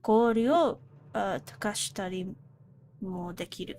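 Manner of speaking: reading Japanese aloud. Acted. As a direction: sound out the Japanese text deliberately. Reads Japanese game text aloud.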